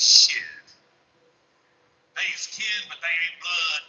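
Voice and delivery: deadpan wife quote. Retold or imitated. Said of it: "with a straight face and goes".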